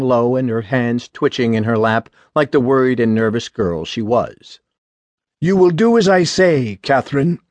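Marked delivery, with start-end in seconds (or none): 5.4-7.4 lightly voiced sick father, higher in pitch; a touch of a frail father laying down the law; weak father. A narrator's reading. Sick father ordering his daughter to obey, weak but firm voice. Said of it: "His voice was weak".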